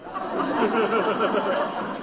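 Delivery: a big laugh, amused; hearty laugh. Real, not acted. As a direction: burst out laughing. Friend laughs at the excuse.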